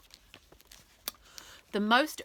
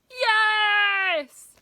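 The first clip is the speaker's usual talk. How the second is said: crowd cheer; 13 semitones higher, 11 dB louder than usual. Acted.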